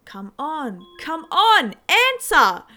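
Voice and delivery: desperate scream building up. A narrator's reading. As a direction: yell at the phone in panic. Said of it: "I screamed at my phone".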